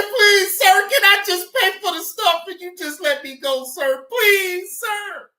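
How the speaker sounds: high, whiny imitation of tearful begging; mock pleading. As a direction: beg in a hammed-up crying voice. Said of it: "She said".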